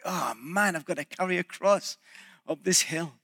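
a weary under-the-breath complaint, lightly acted; put-out Simon. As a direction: grumble it quietly, as if saddled with a chore. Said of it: "perhaps a hindrance. Like".

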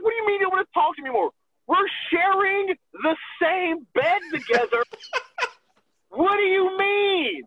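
high, laughing disbelief; pleading with an offended friend in disbelief; incredulous. Retold or imitated. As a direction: plead in mock astonishment. Retold incredulous plea.

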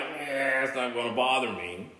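low, soft dismissive "eh"; unbothered shrug. Acted. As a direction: wave it off quietly, voice dropping low. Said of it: "where I'm like".

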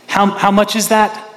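high, loud, fervent sermon delivery; preaching at full force. Acted. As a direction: preach it out to the congregation.

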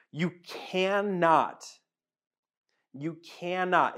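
drawn-out, deliberate "you cannot"; slow emphatic repeat. Acted. Pastor pressing the point on the congregation, very slow. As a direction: stretch it and let it sink in.

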